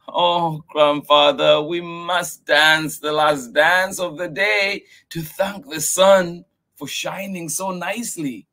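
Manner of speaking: put-on very high, pleading child voice; little girl voice. Miyuki pleading with grandfather. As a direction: beg to keep playing.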